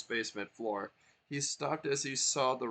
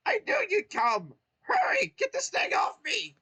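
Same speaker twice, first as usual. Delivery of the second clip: very high, loud shouting squeal; trapped Toad begging to be freed; Toad pleading; 10 dB louder than usual. Acted. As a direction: shriek it out urgently.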